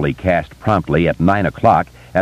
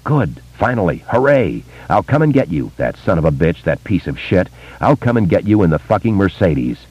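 usual speaking voice

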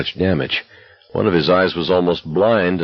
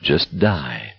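lower, softening voice; resigned; 5 semitones lower, 4 dB quieter than usual. A narrator's reading. Exhausted walker thinking of giving up, low resignation. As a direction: let it sink down in resignation. Thought sinks to dying.

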